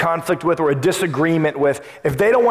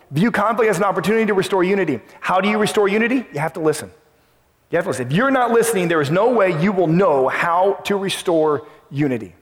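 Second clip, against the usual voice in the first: normal talking voice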